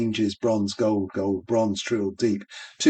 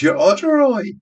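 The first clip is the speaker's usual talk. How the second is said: much higher, louder mocking shout; 5 dB louder than usual. A narrator's reading. Barmaid shouting a joke about the old man's eye. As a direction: fling the question out high and loud.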